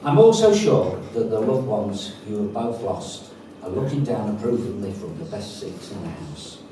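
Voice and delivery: usual speaking voice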